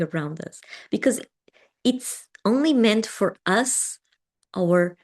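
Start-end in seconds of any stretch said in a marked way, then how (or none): none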